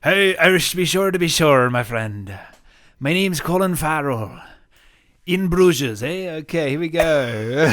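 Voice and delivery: loud, high hammed-up Irish accent. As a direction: ham up a stage Irish accent. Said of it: "Can you give us your Irish accent?".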